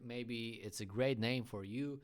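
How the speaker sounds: ordinary talk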